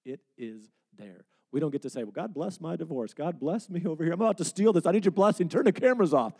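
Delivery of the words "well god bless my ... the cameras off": mock prayer. Mocking a hypocrite's prayer.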